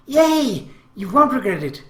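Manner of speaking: put-on high, loud girlish cheer; overjoyed. A narrator's reading. Girl whose date offer was accepted, overjoyed. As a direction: burst out in delight, pitch leaping up. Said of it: "She was so happy".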